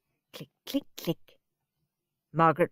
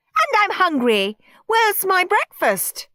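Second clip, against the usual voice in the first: demanding whine; 13 semitones higher, 6 dB louder than usual. Acted. Margaret demanding food.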